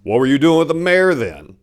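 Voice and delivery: loud, high accusing question; angry demand. A narrator's reading. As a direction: hit her with it hard. Interrogation continues angrily.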